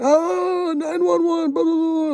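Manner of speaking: very high, loud mimicked emergency fuss; mock alarm. Hospital staff hear of a motorcycle accident, mock alarm. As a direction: imitate staff raising a frantic alarm.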